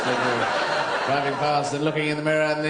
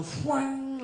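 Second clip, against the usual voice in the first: mock leap noise; 10 semitones higher, 4 dB quieter than usual. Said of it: "They just go".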